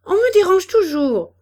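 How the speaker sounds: loud, high complaint; young girl's fed-up outburst; rebellious. A narrator's reading. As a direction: protest indignantly at the top of your register. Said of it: "déclara la jeune fille qui se révoltait".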